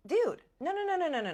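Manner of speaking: high-pitched, loud string of refusals; acted squeal of disbelief; exasperated protest. Acted protest at blaming mother.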